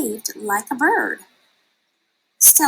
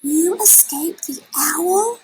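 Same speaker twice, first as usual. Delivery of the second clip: high, slower, amazed; excited cry; 8 semitones higher, slower than usual. A narrator's reading. Stella Luna amazed to find her mother alive, excited cry. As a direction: cry out in joyful disbelief. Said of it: "cried Stella Luna".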